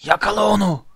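high, strained villain's yell; shouted command. A narrator's reading. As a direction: cry out the order high and urgent. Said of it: "diye bağırdı". (bağırarak emreder gibi)

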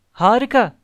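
high, loud exclamation of praise; delighted shout. A narrator's reading. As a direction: cry out in delighted approval. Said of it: "diye bağırdı".

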